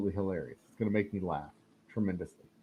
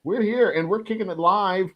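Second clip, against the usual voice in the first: higher, louder hyped-up "here"; hyped announcement; 9 semitones higher, 5 dB louder than usual. Acted. Host announcing the live stream, hyped. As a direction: sell the "live" like a show opener. Restarting the hype.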